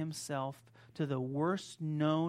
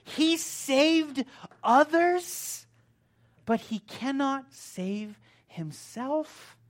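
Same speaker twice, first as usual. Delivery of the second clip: drawn-out mockery; 10 semitones higher, 11 dB louder, much slower than usual. Shown as a demonstration. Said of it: "That's beyond ridiculous".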